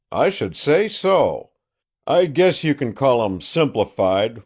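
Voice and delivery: loud, boastful country sheriff on the phone; a gloating sheriff calling an old friend; triumphant sheriff. Acted. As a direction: crow over the news like a self-satisfied lawman.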